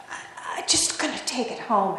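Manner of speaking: soft, overwhelmed half-whisper; acting too choked up to speak up; hesitant, whispered excuse; faltering whisper. Overwhelmed Agnes faltering, acted.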